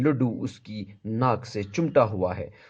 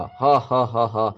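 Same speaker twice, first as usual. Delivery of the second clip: mock laughing. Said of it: "زور سے ہنستا ہے".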